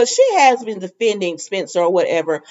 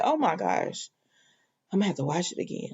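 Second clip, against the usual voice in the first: weary groan; 11 dB quieter, faster than usual. Retold or imitated. Annoyed at pollen again.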